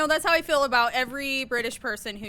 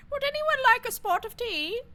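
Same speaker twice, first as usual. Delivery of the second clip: hammed-up British accent, pitched way up; 12 semitones higher than usual. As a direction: trill it like a cartoon British host. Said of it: "That's my British accent".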